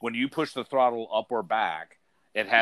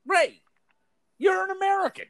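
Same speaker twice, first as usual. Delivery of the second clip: high, squeaky mock disbelief; incredulous teasing squeak at the coworker; mock surprise; 3 dB louder than usual. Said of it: "And I was like".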